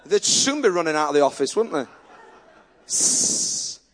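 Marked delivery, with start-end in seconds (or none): 2.9-3.8 a long hissing snake sound. Pretend. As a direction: hiss like a snake rising from a staff. Snake appears from the staff.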